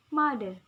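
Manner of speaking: "Mother" with MO hit very loud; stress on first syllable. Shown as a demonstration. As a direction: punch MO in "Mother" for learners to repeat. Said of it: "at the ma".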